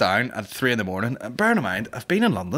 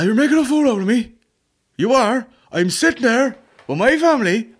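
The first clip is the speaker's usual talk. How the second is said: shouting traveller; 14 semitones higher, 7 dB louder than usual. Retold or imitated.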